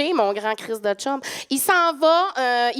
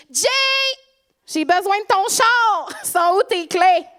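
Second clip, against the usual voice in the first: calling out; 8 semitones higher, 3 dB louder than usual. Acted. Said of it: "j'entends quelqu'un crier".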